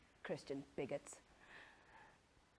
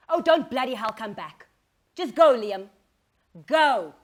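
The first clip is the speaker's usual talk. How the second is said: loud, angry yelling; furious shout; 17 dB louder than usual. Acted. Wife yelling at Liam to get out, furious. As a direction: throw him out at full volume.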